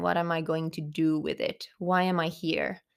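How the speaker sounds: ordinary delivery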